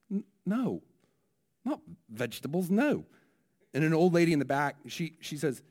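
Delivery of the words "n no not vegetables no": mildly offended Kiwi minister, higher; a touch of huffy New Zealand correction. Retold or imitated. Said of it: "he's slightly offended".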